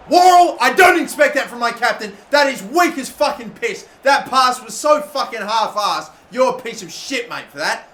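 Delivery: very loud, high, furious shouting; real furious outburst; angry rant. Real, not acted. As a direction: tear into your own captain. Rant at captain Worrell.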